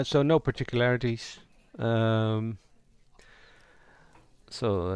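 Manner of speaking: ordinary delivery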